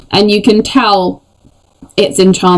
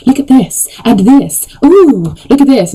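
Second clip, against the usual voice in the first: hammed-up shopping channel host, higher; telesales lady; 5 semitones higher than usual. Dwarf showing off his pickaxe like a telesales host. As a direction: show it off like a TV sales lady. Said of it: "one of those ladies on the telesale channels".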